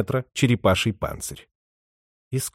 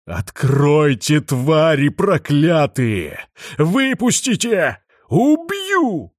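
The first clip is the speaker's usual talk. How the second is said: slow, louder sepulchral howl; grim reaper; 4 semitones higher, 4 dB louder, much slower than usual. A narrator's reading. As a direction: howl from beyond the grave, rising to a shriek. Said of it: "замогильный голос взвыл".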